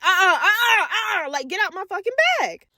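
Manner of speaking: high, sharp "uh-uh" scolding. Retold or imitated. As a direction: shut him down fast and firm. Scolding cousin over the bag.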